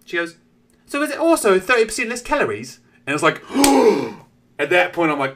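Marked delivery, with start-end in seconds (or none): 0.9-2.8 high piping question; mum in kid voice. Retold or imitated. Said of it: "same voice as the kid". 3.5-4.2 mock gasp. Shock at calorie question.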